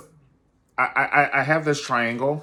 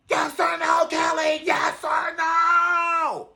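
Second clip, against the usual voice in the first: full-throated, high-pitched screaming tirade; mock furious yelling; 7 dB louder than usual. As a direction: imitate a raging man screaming orders. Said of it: "but I don't yell at it".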